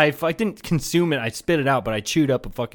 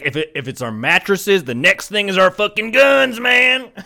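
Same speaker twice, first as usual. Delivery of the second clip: gun nut quote; 6 semitones higher, 6 dB louder than usual. Retold or imitated. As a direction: play up a mock gun-rights zealot, getting louder. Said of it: "They're like".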